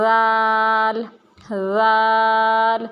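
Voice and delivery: ذال said softly, tongue between the teeth, drawn out; gentle long ذال; soft zaal. Shown as a demonstration. Teacher showing a soft ذال like سا. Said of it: "نرمی سے پڑھنا".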